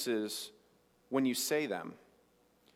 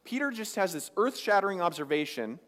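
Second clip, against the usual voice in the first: ordinary talk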